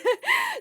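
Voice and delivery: high burst of laughter. Real, not acted. Mocking her own Spanish, a high laugh. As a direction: laugh at yourself.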